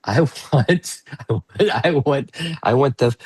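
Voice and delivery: playful, emphatic voice of wanting; mock insistence; protesting inner voice. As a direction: act out an insistent inner part, laugh breaking in. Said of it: "the voices that say".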